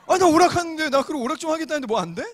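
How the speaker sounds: 높고 크게 치고 들어가 대드는 동생 흉내; 억울하다는 듯 과장해서 따지기; 발끈한 항변. Retold or imitated. 누나의 핀잔에 대드는 동생. (발끈해서 따지는 흉내)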